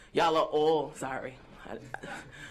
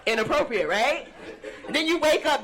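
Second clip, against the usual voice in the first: usual speaking voice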